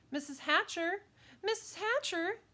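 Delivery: put-on high, loud girl's call; insistent high-pitched calling; eager calling. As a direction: call out to get a grown-up's attention. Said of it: "Sheila called".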